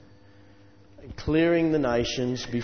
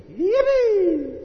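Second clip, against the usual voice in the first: high, loud drawn-out yippee; gleeful yell; 6 dB louder than usual. Acted. Preacher acting out the Israelites cheering, gleeful yell. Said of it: "the people yelled with glee".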